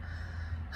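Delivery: a breathy, voiceless sigh. Acted. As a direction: let out a long tired sigh. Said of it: "sighed her mother".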